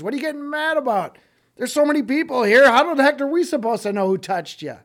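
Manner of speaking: mock the question, a bit sassy; flippant disciples. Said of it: "disciples are even getting a little bit flippant".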